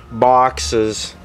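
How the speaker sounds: ordinary delivery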